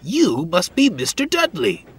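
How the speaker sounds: high, perky character voice; grinning. A narrator's reading. Toad greeting Dudley in the siding, grinning perkily. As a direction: chirp it with cheeky politeness. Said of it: "grinned Toad".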